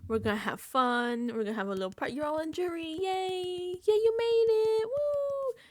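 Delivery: high squealing fake party cheer; rising to a giddy high Yay, woo; fake cheerful card. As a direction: sell the party like a bubbly host. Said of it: "the card lies and says".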